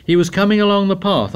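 fast, high, eager telling; bidding for attention. A narrator's reading. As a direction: rush the story to win listeners. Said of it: "He tried for their attention".

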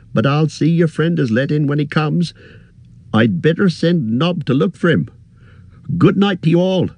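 innkeeper voice. Acted.

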